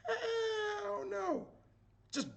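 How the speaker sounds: very high, soft squeal of refusal; comic refusal. Shown as a demonstration. Chess teacher refusing to move the piece away, comic squeal. Answers own rhetorical question.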